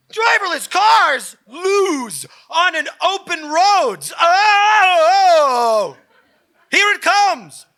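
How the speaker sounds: crying shout, high and loud; frantic mock wail. Commenter panicking on the roads, mock wailing shout. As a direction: wail it like the end is near.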